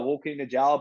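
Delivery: usual speaking voice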